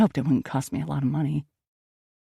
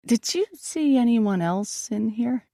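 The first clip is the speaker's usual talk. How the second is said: slow, each word drawn out; slow question; 6 semitones higher, slower than usual. Acted. Perry slowly asking the twins about Dex, slow. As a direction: ask it carefully, slowly. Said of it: "I asked slowly".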